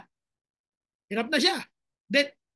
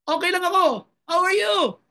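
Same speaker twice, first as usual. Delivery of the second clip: mock cheerful pretender; 6 semitones higher, 6 dB louder than usual. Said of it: "Hindi siya nag-pretend".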